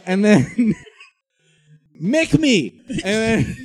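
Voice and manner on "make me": mock taunt.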